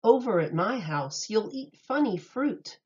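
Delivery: plain reading voice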